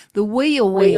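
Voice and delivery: loud Southern "wheel wheel"; Southern accent. Shown as a demonstration. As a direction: punch out WHEEL WHEEL with Southern vowels. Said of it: "only say it with a Southern accent".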